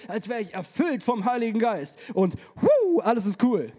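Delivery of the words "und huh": a high loud huh, playful; mock spirit-filled gasp. Preacher mocking the feeling of being filled, high loud huh.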